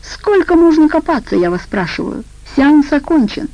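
harsh impatient outburst; rough shout. Acted. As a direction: yell at her to hurry up. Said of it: "грубовато прикрикнула на женщину".

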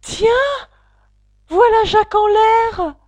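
cri aigu et fort de la foule; crie-le comme toute une foule ébahie; cri général. Acted. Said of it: "un cri général de". (cri général de surprise, plus aigu et fort)

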